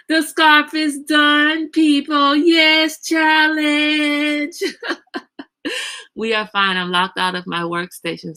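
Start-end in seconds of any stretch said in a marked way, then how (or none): 0.1-4.6 high, loud, drawn-out cheer; triumphant announcement. Real, not acted. Announcing the finished scarf to the group, loud triumphant cheer. 4.7-5.4 high breath-light laugh. Real, not acted. Host laughing after her scarf announcement, high amused laugh.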